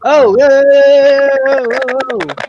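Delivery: loud, slow drawn-out whoas; stunned by breaking news; surprised. Real, not acted. Reacting to surprise trade news.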